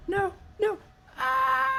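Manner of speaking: high, thin mock cry for help; faint distant yelling. Game master voices someone in danger behind the door, faint high yell. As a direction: wail as if heard faintly through a door. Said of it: "a bit of a yelling noise".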